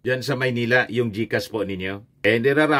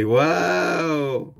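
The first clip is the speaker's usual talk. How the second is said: mock wow; 5 semitones higher than usual. Father impressed.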